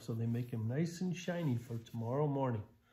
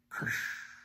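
whispered crash sound effect; dumping noise. Pretend. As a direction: make the sound of dirt spilling. Dump truck spilling load.

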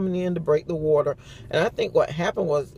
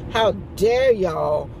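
slow, drawn-out indignation, pitched up; mock mad; 4 semitones higher, 3 dB louder, slower than usual. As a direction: play an outraged newborn, stretching the words. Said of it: "He was mad".

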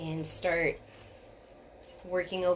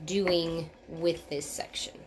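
ordinary talk, nothing marked

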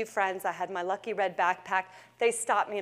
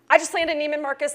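"I just landed Neiman Marcus" said higher and louder, bursting with joy; excited repeat; 5 semitones higher, 4 dB louder, faster than usual. Retold or imitated. Repeating the news to stunned Sam, now excited. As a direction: shout the news the second time. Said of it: "Excuse me?".

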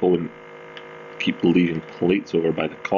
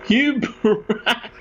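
high mock outrage; 12 semitones higher than usual.

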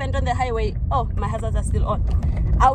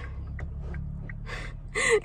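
breathy, high, helpless giggle; nervous high laugh; 14 semitones higher, 11 dB quieter than usual. Real, not acted. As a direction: laugh off the panic at the wheel. Car stalling on a hill.

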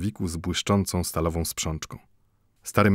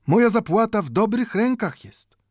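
proud final word, much higher; Mendel, higher; 13 semitones higher, 4 dB louder than usual. A narrator's reading. Mendel closes his plea to the watchmaker, higher.

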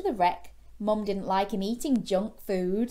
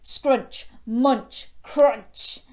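loud, punchy, separate bursts; chomping sound words; 4 semitones higher, 8 dB louder, much slower than usual. A narrator's reading. Storyteller acting out Gilbert eating, loud punchy chomps. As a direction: bite hard on each word.

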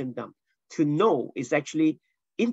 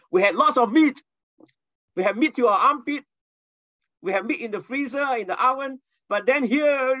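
higher, louder whiny grumbling; complaining Israelites; 8 semitones higher, 4 dB louder than usual. Retold or imitated. Preacher mimics Israelites grumbling to Moses, high whiny complaint. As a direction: whine like spoilt people missing meat. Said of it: "Israelites complaining".

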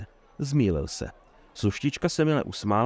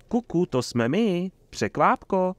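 high, slow teasing sing-song; playful; 8 semitones higher, 3 dB louder, slower than usual. A narrator's reading. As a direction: peekaboo voice to surprise a friend. Playful surprise greeting from troubadour. (rozverně, protáhle a vysokým hlasem)

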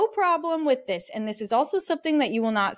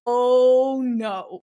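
loud, drawn-out oh no; mock dread; 7 dB louder than usual. Breeder recalling group chat drama, mock dread. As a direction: groan out a big mock dismay.

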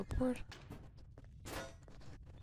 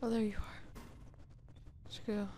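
breathy whisper of relief, barely voiced; relieved whisper; 9 dB quieter, faster than usual. Real, not acted. Player finds lost companion, whispering with relief. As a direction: hush it out, relieved.

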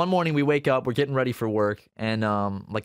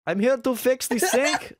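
high, slow mock Middle Eastern accent; imitated Middle Eastern voice, drawn out; goofy Middle Eastern voice; 11 semitones higher, slower than usual. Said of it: "maybe a goofy Middle Eastern voice".

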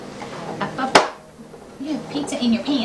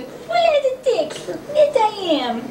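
high squeaky laughing coo; 10 semitones higher than usual. Real, not acted. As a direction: giggle it out high. Cooing at baby, laughing.

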